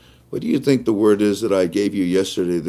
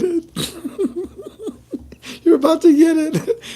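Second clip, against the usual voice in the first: high, laughing squeal of glee; giddy squeal. Real, not acted. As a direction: squeal it out with delight. Excited teasing cry.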